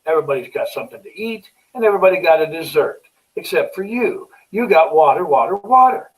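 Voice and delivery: a waiter growing fed up, voice climbing; miffed waiter. Retold or imitated. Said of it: "the waiter gets a little miffed and says".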